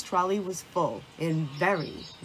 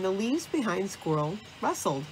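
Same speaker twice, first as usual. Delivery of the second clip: usual speaking voice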